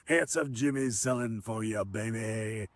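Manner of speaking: put-on quiet, smiling echo of the old wrestler; fond replay of Handsome Jimmy. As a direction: savour the line quietly, smiling. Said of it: "he said that".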